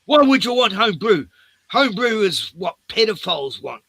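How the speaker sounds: high, loud scandalised shop worker; indignant Karen. Retold or imitated. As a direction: play her outraged and accusing. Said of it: "She goes".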